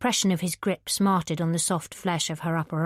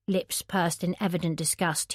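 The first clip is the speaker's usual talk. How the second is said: usual speaking voice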